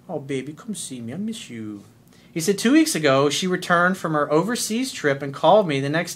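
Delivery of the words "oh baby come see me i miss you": put-on coaxing boyfriend. Shown as a demonstration. Coach showing how to invite the girl over, softly. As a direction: lure her over gently.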